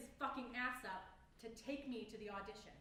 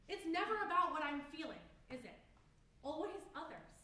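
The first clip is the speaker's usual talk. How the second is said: raised, higher-pitched angry outburst; bitter accusation; 4 semitones higher, 5 dB louder than usual. Acted. Accusing family who never consider their feelings, bitter and loud. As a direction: throw it out loud and hurt.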